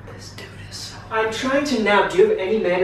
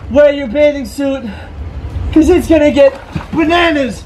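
excited; 8 semitones higher, 7 dB louder than usual. Acted. Hyping up a wild party.

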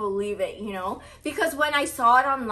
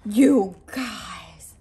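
breathless whisper after a gasp; gasp, then barely breathe the words; 5 dB louder than usual. Real, not acted. Vlogger stunned by the bag, gasping whisper.

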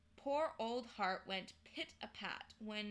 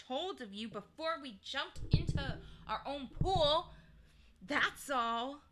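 heated sarcastic tirade, mostly higher and louder; 4 semitones higher, 5 dB louder than usual. A narrator's reading. Polly listing Digory's wrongs to him, sarcastically. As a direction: pile up the accusations with heavy irony. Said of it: "said Polly sarcastically".

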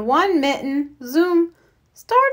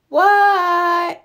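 a high, loud what; yelled; 5 semitones higher, 4 dB louder than usual. A narrator's reading. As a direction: shout back from outside. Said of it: "yelled Froggy".

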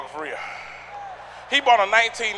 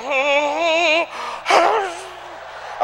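humming after a breath; 5 semitones higher, much slower than usual. Retold or imitated. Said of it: "Then he gave out a breath".

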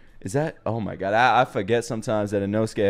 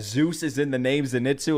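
ordinary talk

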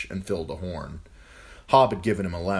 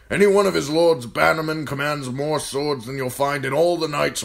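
put-on raised, loud commanding old man; gruff old lord commander; 10 semitones higher, 8 dB louder than usual. A narrator's reading. Old commander ordering John to serve food, loud and gruff. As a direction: bark orders at the boy like an old soldier. Mormont voiced in story.

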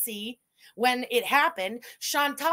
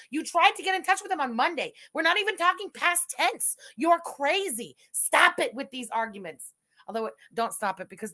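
ordinary talk, nothing marked

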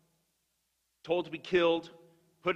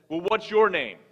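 a hint of a louder, threatening burglar; menacing burglar; 7 dB louder than usual. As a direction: lean in on the old man, lightly menacing. Burglar threatening the old man.

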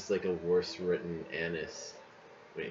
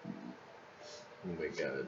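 soft and very slow; defeated groan; 8 semitones lower, 14 dB quieter, much slower than usual. Real, not acted. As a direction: sigh it out, drained. After losing badly.

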